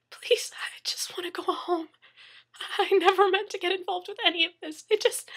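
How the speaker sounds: desperate pleading. Acted. Captive begging for his life.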